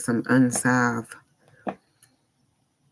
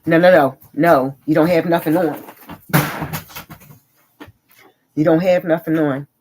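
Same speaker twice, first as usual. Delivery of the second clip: loud, higher sharp telling-off; scolding shout; 4 semitones higher, 6 dB louder than usual. Real, not acted. Scolding daughter off-mic.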